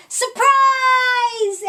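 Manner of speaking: loud, high party shout; yelled surprise. A narrator's reading. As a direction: burst out yelling to surprise him. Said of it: "everyone yelled".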